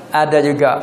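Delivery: usual speaking voice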